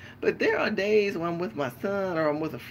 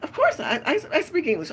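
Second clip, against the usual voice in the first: quoting the man; 10 semitones higher, 4 dB louder than usual. Retold or imitated. Man answers, quoted.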